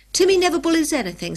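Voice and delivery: quick, high, loud retort; defensive and fast; indignant. A narrator's reading. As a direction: leap to the dog's defence. George snaps back defending dog.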